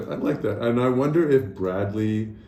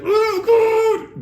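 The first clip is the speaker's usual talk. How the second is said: high, loud mock-panicked cry; dramatic fright; 6 dB louder than usual. Mimicking overdramatic plane passengers, mock panic.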